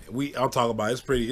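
ordinary delivery

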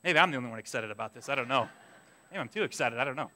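low, soft, quick aside; deflated aside. Real, not acted. Preacher to unmoved listeners, low sheepish aside. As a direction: drop right down, as if second-guessing your excitement.

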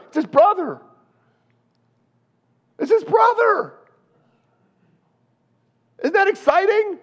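high, bright shouted news; excited servant. Acted. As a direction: squeal the good news way up high.

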